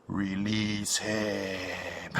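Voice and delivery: very slow, low and soft; slow deep command. Retold or imitated. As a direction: intone it slowly like a Jedi. Quoting a commanding figure.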